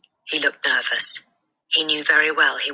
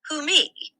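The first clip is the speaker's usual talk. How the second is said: puzzled; 14 semitones higher than usual. A narrator's reading. The boy answers the headmistress puzzled. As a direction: ask it honestly baffled. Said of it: "genuinely puzzled".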